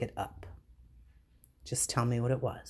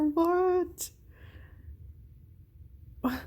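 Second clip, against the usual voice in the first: shocked what. Real, not acted. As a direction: shriek it out in pure disbelief. Shocked by a movie title fact.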